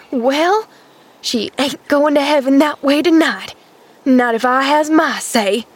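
high, determined servant voice; dogged country-woman insistence. A narrator's reading. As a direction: declare it stubbornly.

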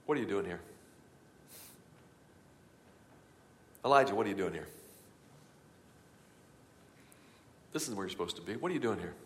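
soft, low, hushed voice of God; a quiet searching question, barely above breath; gentle whisper of God. Acted. God whispering to Elijah in the cave, softly. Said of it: "God whispered and he said this".

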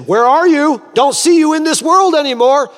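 high, loud far-carrying call. Acted. Believer far ahead yells back to Jesus, calling out. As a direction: yell back across a hundred yards.

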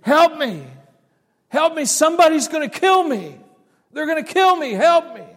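high, loud panicked screams; woman screaming for help. Retold or imitated. Woman fleeing down the road, screaming in terror. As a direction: scream for your life. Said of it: "I heard it more clearly".

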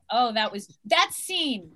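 usual speaking voice

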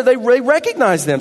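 loud, driving pulpit delivery, pushed fast; preaching at full force. Acted. Pastor fires up the congregation, loud and driving. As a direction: preach it out big to the church.

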